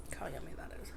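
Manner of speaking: soft breathy whisper. Real, not acted. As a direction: whisper it close to the mic. Shows food to viewers.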